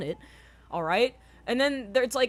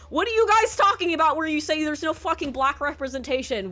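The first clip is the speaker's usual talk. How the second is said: loud, high, fast yelling at the critics; furious yelling; 9 semitones higher, 6 dB louder than usual. Real, not acted. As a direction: scream the questions in disbelief. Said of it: "I'm so fucking triggered right now".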